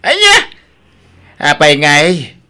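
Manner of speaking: loud, high, furious father; outraged shout. Acted. Father shouting in outrage at the news about his daughter. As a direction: blast the news with fury.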